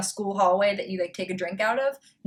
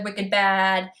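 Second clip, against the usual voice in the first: ordinary talk, nothing marked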